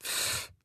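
soft scornful unvoiced puff; dismissive pff. Verächtliches Pff. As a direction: sister scoffing at her little sister. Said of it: "macht Manja".

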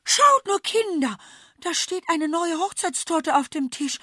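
high, loud delighted squeal; excited cry. Acted. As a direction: burst out in delight. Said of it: "einen spitzen Schrei ausstößt".

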